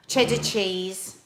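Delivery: ordinary talk, nothing marked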